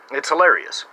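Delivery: ordinary talk, nothing marked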